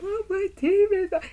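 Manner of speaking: high, loud panicked mother; mom freaking out. Retold or imitated. Daughter mimicking her mother panicking over the TV, high and loud. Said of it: "she's like".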